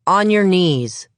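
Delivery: loud, slow command; firm order. Acted. Detective ordering the suspect down, loud and slow. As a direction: bark it slowly, in control.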